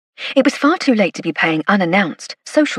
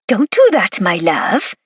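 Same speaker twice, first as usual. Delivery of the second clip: put-on broad Valleys accent; 3 dB louder, slower than usual. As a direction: scold him in a broad Valleys Welsh accent. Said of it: "in Nellie's broad Valleys accent".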